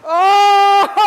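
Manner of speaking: high, loud yell into laughter; loud surprised shout and laugh; surprised shout then laugh. Real, not acted. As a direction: cry out, then crack up. Goalie makes a big save.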